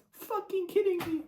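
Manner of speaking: high incredulous squeak; squeaky disbelief. Real, not acted. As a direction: squeal it in outrage. Disbelief at post-credit scene.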